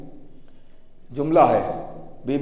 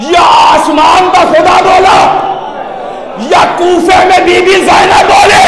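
loud, raised preaching at full force; fiery preaching; 9 semitones higher, 5 dB louder than usual. Acted. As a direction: rouse the gathering like a majlis orator. (जोशीली तक़रीर, बुलंद आवाज़ में)

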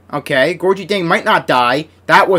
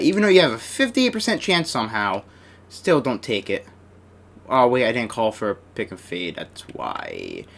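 ordinary talk

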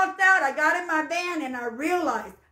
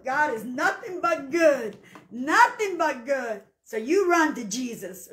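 ordinary delivery

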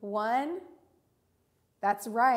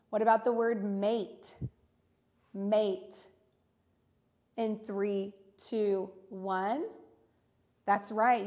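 ordinary talk

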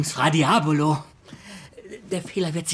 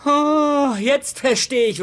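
much higher, louder Oh, then slow; mock realization; 10 semitones higher, 3 dB louder, much slower than usual. Woman catching on to her ex's real motive, pointedly surprised. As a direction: act out dawning suspicion. Holly sees through her ex.

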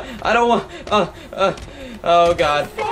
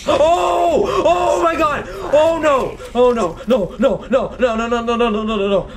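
panicked yelling; 4 semitones higher, slower than usual. Real, not acted. Panicked outburst at jumping enemy.

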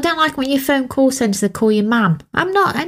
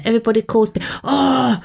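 normal talking voice

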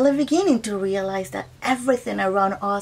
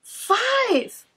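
high, eager shout of the answer; proud, high answer; excited answer; 11 semitones higher than usual. Acted. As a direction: call it out like you know it. Answering the counting question.

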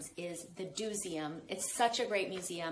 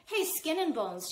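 higher, louder mocking call; taunting girl; 9 semitones higher, 5 dB louder than usual. A narrator's reading. Older girl taunting Somkit, mocking call. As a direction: call out to tease him.

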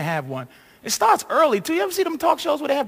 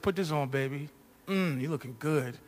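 much softer, low coaxing voice; low seductive murmur; 8 semitones lower, 10 dB quieter than usual. Retold or imitated. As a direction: coax her in a low hush. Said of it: "I said".

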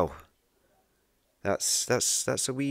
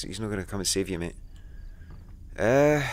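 normal talking voice

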